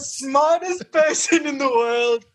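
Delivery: high, slow snooty voice; mock snobbery. Guest finishing the joke boast to co-host, mock snobbery. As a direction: draw out the pretend superiority.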